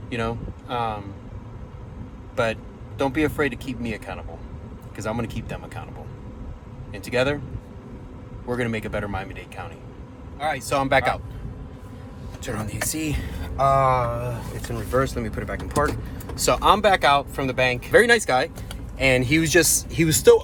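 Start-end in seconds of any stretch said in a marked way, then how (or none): none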